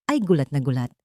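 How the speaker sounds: usual speaking voice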